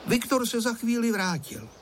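ordinary delivery, nothing marked

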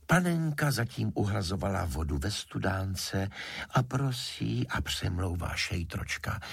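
ordinary delivery

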